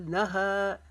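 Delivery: नहा with the last aa drawn out; long final हा. Shown as a demonstration. Teacher showing students the alif-length madd, नहा held long. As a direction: stretch the final vowel of नहा. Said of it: "हा को अलिफ जितना लंबा खींचेंगे".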